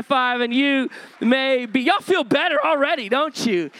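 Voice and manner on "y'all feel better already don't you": normal talking voice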